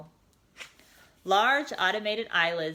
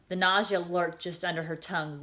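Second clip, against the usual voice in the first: usual speaking voice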